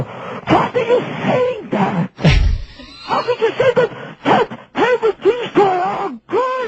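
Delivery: very high-pitched mock screech; imitated outraged girl. Little girl answering his dirty remark, mock outraged screech. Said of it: "and she'll go".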